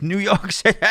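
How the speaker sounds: hammed-up, high impression dissolving into laughter; high, over-the-top exclamation, cracking up; Matt Berry. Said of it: "the way Matt Berry says".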